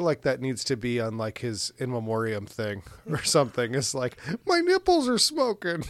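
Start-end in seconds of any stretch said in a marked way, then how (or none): 4.5-5.8 high-pitched imitation of a movie yell; mock yell. Quoting the movie line for an in memoriam reel, mock yelling. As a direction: squeal the line in a comic mock cry.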